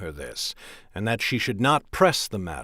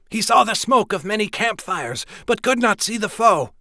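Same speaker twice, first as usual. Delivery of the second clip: much higher, urgent messenger voice; 11 semitones higher than usual. A narrator's reading. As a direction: call out the alarm in a raised voice. Messenger answers.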